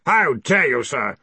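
pompous outrage; affronted. A narrator's reading. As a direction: rebuke him with wounded authority. Ridcully affronted at his accuser.

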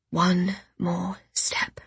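slow, exhausted whisper. Acted. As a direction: breathe it, barely able to go on. Exhausted, forcing herself onward.

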